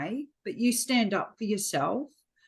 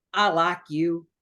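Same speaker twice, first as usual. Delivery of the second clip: badly faked New York doorman; attempted New Yorker voice; bad New York accent; 4 dB louder than usual. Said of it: "really bad New York accent".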